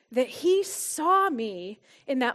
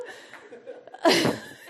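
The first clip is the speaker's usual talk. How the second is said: breathy embarrassed laugh. Real, not acted. Laughs at herself.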